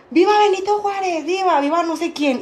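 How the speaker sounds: high-pitched mock cheer; imitated rallying cry. Headmistress leading independence cheers at school, imitated cry. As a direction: lead a school cheer without really shouting. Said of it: "empezaba a gritar".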